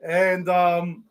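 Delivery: usual speaking voice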